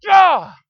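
sudden high, loud cry of disgust; disgusted yell. Retold or imitated. Preacher reliving fingernail discovery, loud disgusted cry. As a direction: yelp like you just bit something foul.

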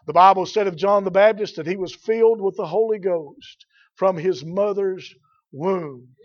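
usual speaking voice

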